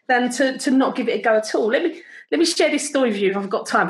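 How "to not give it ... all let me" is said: ordinary talk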